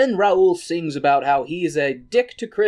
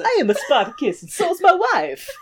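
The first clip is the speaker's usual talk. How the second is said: high laughing mock Italian accent; hammed-up Italian; 10 semitones higher than usual. Gladiator claiming to be Spartacus in a mock Italian accent. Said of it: "Terrible Italian stereotypes".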